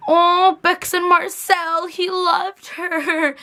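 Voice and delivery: high, slow, doting gush; gushing aww. Real, not acted. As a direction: melt over the cute couple. Fangirling over a cute couple.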